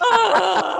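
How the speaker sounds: very loud, high mocking groan; mimicked groan. Partner repeating the groan back, loud mimicry. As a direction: parrot an effort groan at full volume. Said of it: "And he's like".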